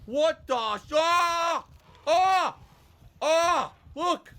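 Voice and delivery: high, loud frightened yelps; startled yells. Real, not acted. Gamer startled by ghost pants, loud yelps. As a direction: yelp in real fright.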